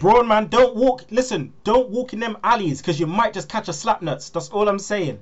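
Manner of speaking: loud, high, emphatic caution; mock warning. Podcaster warning Braun about dark alleys, loud joking warning. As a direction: punch it out like a street warning.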